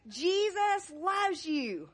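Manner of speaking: slow, bright and joyful; excited. Shown as a demonstration. Children's pastor leading a jumping, clapping praise. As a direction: cheer it out while jumping. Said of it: "clap and jump up and down".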